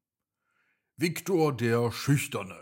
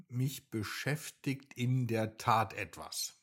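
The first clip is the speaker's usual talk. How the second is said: ordinary delivery, nothing marked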